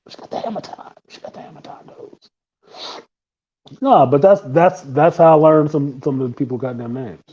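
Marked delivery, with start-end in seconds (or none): 0.1-2.2 whispered aside; whispering. Real, not acted. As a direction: drop to a hushed whisper.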